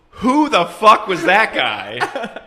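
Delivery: high, very slow, bewildered, laughing through it; drawn-out disbelief after a slap; stunned Pacino. Retold or imitated. Slapped Pacino asks the cops who the stranger was: high, slow, stunned disbelief.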